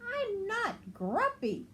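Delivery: high, slow grumpy insistence; insisting. Acted. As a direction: deny it stubbornly in a high voice. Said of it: "Jim insisted".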